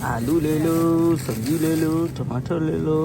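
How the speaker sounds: sing-song street hawker cry; melodic vegetable vendor call. Acted. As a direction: chant the wares like a market seller. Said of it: "किस तरह से आवाज़ लगा".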